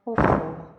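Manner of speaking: loud, low huff of annoyance; exasperated. Acted. Haifa, teased on the phone, loud exasperated huff. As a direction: blow out the annoyance.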